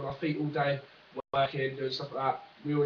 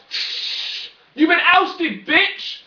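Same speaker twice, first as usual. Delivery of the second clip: mock triumphant yell; 12 dB louder, much slower than usual. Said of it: "and be like".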